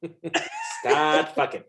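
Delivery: high, drawn-out mock seduction; silly put-on voice. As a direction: stretch it out in a silly voice, breaking into laughter. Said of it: "What is that voice?".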